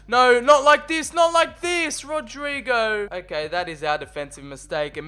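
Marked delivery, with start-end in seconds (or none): 0.1-3.1 higher, louder anguished protest; watching his defender lose the ball, loud and pained; dismayed cry. Real, not acted. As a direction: plead against the mistake as it unfolds. Defensive blunder leads to goal.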